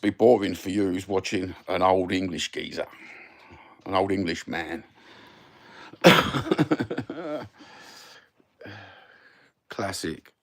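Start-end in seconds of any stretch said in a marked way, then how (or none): 5.9-7.4 loud, high burst of laughter. Real, not acted. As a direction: burst out laughing, then let it tail off. Amused at calling himself old geezer.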